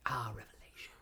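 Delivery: hushed, whispered echo. Acted. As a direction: whisper it as if quietly awed. Mock-awed echo of guest's word.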